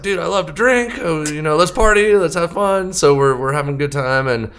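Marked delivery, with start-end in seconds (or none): 0.0-2.9 high, eager party invite; mock-eager young guy; excited. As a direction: pitch it up like you can't wait to drink. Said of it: "I was like".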